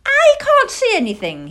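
high, loud little owl voice; young owl's loud protest; complaining owlet. Acted. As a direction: complain in a piping child voice. Plop complains at wrong telescope end.

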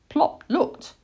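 ordinary delivery, nothing marked

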